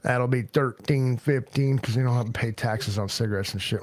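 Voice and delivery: normal talking voice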